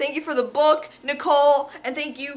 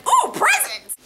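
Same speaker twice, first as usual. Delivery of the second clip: high, loud gleeful squeal; excited; 7 dB louder than usual. Acted. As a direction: squeal it like a kid getting gifts. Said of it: "I'm always excited when I get".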